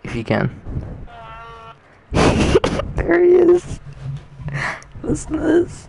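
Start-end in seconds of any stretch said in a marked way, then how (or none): 2.1-5.9 much higher, squealing with glee; high gleeful announcing; excited squeal. Real, not acted. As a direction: squeal it like you just caught him. Excited at friend's sound.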